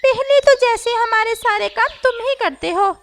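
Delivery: mock his empty threat; sarcastic parrot. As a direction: parrot scoffing at the crow. Parrot mocks crow.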